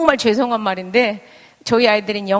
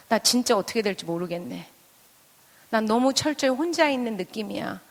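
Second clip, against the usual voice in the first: lost inner voice; 7 dB quieter than usual. Acted. Parent admitting they feel all alone, quiet helplessness. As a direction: voice a helpless private thought quietly. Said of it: "사실 진짜 마음속은 제가 어떤 마음인 줄".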